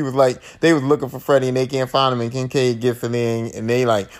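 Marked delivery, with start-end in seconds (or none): none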